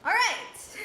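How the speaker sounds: loud high victory shout; triumphant cheer. Real, not acted. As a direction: celebrate making it out. Triumphant after escape.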